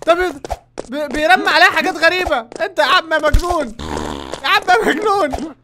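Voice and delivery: high, loud frantic complaint; frantic yelling. Real, not acted. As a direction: yell at the crazy cook. Cook throws things at him.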